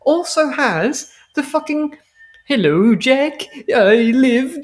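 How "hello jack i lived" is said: lilting, louder mock Swedish chef accent. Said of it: "Swedish chef accent".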